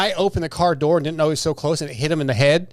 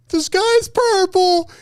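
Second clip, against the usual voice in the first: mock panic; 3 dB louder than usual. Stepdaughter fearing tornadoes, mock scared and high. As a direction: ham up a kid's tornado panic.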